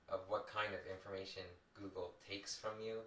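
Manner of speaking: usual speaking voice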